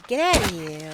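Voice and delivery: loud New York, get out of here; imitating a New Yorker; mock New York. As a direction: brush someone off, New York style.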